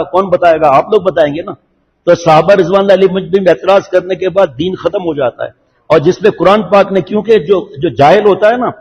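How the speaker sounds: normal talking voice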